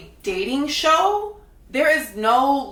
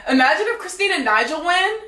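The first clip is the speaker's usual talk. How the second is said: much higher, giggling imitation of her; laughing mock quote; 7 semitones higher than usual. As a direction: mimic her laughing taunt. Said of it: "This girl starts laughing".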